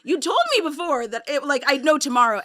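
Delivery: loud, fast, exasperated complaint; indignant rant. Retold or imitated. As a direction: vent at the agent, loud and quick. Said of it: "it's like".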